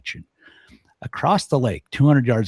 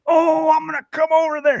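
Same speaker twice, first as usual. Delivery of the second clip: put-on high, loud threatening old-man holler; angry old man; 6 dB louder than usual. Old man yelling threat across the lake, high angry shout. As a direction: yell it like a furious geezer spoiling for a fight.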